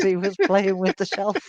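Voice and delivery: laughter breaking into the words; amused laughing. Real, not acted. Wife telling of husband playing with the shelf, amused laughing. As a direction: talk through a giggle.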